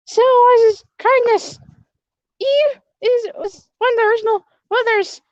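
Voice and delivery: high princess voice, loud at first; a timid princess stumbling through her lines; nervous princess. Acted. Speaker voices Princess Eve character.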